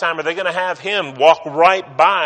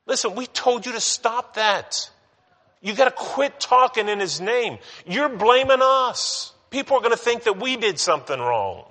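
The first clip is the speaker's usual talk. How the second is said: much higher, exasperated council scolding the apostles; shrill protest, pitched up high; 9 semitones higher than usual. Retold or imitated. Preacher voicing council scolding Peter, exasperated and high.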